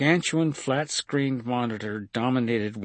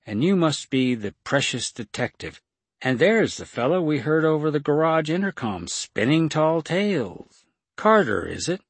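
higher, lilting mock-polite voice; smug woman captor; 7 semitones higher, 5 dB louder than usual. A narrator's reading. Liza sizing up detective and Carter, mock-polite. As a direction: toy with the captives, stretching the tune.

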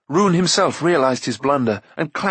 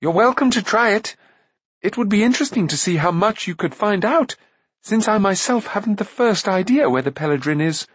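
high, animated, plucky prince; stout defiance; 9 semitones higher than usual. A narrator's reading. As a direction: dare her in a high, eager voice. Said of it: "he faced Akron stoutly".

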